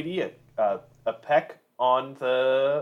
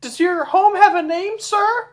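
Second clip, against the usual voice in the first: much higher, overly polite voice; polite giant speech. Acted. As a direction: play an eager host making small talk. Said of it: "This is in giant, obviously".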